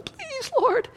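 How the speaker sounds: high-pitched pleading prayer; desperate mock plea. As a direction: squeak out a comic plea to God. Acts out single person pleading.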